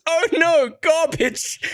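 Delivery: put-on high, childlike "oh no, garbage"; imitating his daughter. Dad imitating his daughter pointing at an ad, childlike. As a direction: play the little girl dismissing an ad. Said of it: "she just points at it and goes".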